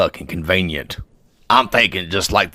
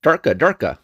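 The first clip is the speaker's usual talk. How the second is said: mock gibberish; 5 semitones higher than usual. Mock gibberish reply to an oath.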